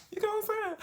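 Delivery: squeaky high question; high-pitched plea; high squeak. Real, not acted. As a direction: pitch it way up, pleading.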